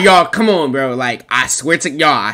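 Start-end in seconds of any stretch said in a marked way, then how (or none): none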